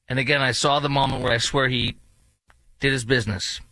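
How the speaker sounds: normal talking voice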